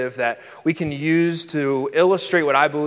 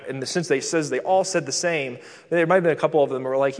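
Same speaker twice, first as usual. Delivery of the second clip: ordinary talk, nothing marked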